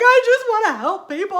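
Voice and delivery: high, loud, tearful wail; retold sobbing plea. Wife sobbing to her husband that she wants to help. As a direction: cry it out like you're bawling. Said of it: "I'm bawling my eyes out".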